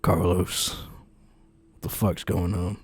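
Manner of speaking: low, slow demand at the shoulders; brother shaking him. Acted. Brother holding Carlos's shoulders, slow low demand. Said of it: "he takes you by the shoulders".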